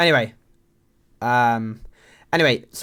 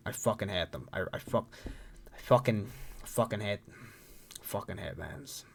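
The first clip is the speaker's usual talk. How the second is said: hushed loathing; 3 semitones lower, 9 dB quieter than usual. Real, not acted. Admitting he hates veins, hushed loathing. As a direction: sink into a quiet, halting confession of hatred. Confesses his hatred of veins.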